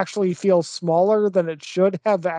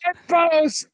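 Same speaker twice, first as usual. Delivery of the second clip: high-pitched parody of an over-the-top battle cry; jokey hammed-up movie line; mock Sparta cry; 10 semitones higher than usual. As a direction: drag out each word, high, through laughter.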